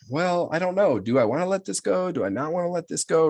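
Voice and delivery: high, singsong dithering; wavering indecision. Acted. Client asking themselves whether to let it go, wavering. Acting out indecisive self-questioning.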